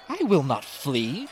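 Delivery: high, drawn-out refusal; stage shout with the volume held back; shouted defiance. A narrator's reading. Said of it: "she shouted".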